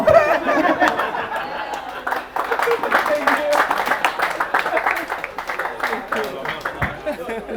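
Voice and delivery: long high laughter; hearty laugh. Real, not acted. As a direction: burst out laughing. Laughing at joke about customer.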